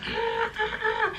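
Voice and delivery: put-on very high squeaky baby "Ah"; imitating baby fussing. Mother imitating her newborn's fussing cry, high and squeaky. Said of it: "But she's like".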